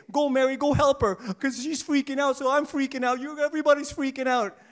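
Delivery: loud, high joking panic; mock frazzled Jesus. Preacher mocking a wrong answer, loud joking panic. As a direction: ham up a flustered reply. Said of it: "what did Jesus say".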